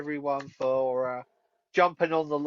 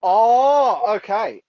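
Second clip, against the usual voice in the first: excited; 7 semitones higher, 10 dB louder, much slower than usual. Real, not acted. Asked about Eden Lake.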